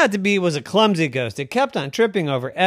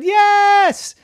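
put-on excited child; 7 dB louder than usual. A narrator's reading. Mickey insists.

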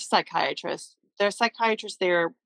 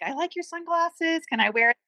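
high, childlike mock plea; lightly playing the daughter in the ambulance, asking sweetly; imitating her daughter; 11 semitones higher than usual. Mother imitating her daughter to the ambulance tech, high and childlike. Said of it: "you were telling the guy, like".

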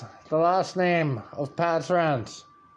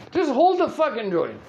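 high-pitched, urgent retold order; insist, acting out your exasperation; exasperated insistence; 9 semitones higher, 3 dB louder than usual. Comic urging his friend to hold the joint, exasperated. Acted argument over the joint.

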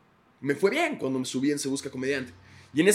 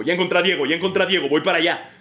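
producer on radio; 5 semitones higher, 6 dB louder, faster than usual. Retold or imitated.

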